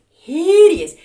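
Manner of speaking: "hideous" loud and high; emphatic disgust. Real, not acted. Vlogger condemning homecoming mums, emphatic "hideous".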